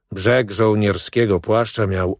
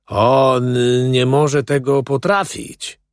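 slow, raised scornful drawl; drawn-out, higher, belittling tone; contemptuous dismissal; 4 semitones higher, 4 dB louder, slower than usual. A narrator's reading. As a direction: brush him off with disdain. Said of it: "rzekłem z pogardliwym lekceważeniem".

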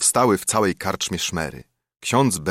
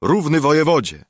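higher, louder rallying cry; agreeing shout; 7 semitones higher, 4 dB louder than usual. A narrator's reading. The gentry backing Skołuba, loud agreeing shout. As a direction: shout it as one with the crowd. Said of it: "krzyknęli wszyscy".

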